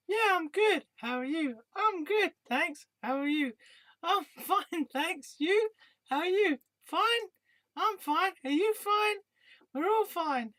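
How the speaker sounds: high, hollow small-talk voice; bored mechanical politeness. Acted. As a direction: rattle off pleasantries on autopilot. Scripted small-talk skit, high voice.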